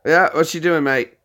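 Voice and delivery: mock British accent, pushed up and loud; Brad. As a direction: imitate a British pal on a prank call. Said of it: "I was doing Brad's voice".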